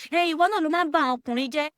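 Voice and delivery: friends scolding. Retold or imitated. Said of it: "some of my friends be like".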